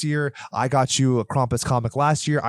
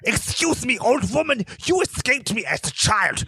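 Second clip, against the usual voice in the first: put-on dramatic German accent; 9 semitones higher than usual. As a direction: play a pompous Krampus with a German accent. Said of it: "a very dramatic German accent".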